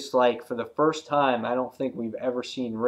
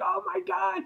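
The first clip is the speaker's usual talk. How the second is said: much higher, thin gasp of agony; pained gasp; 4 dB quieter than usual. Retold or imitated. Bitten man, very high pained squeal. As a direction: squeal it as the bite gets harder. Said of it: "and he's like".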